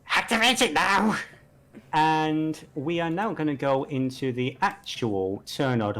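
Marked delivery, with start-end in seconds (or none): 0.1-1.2 mad villain command. Acted. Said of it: "he's just cackling madly".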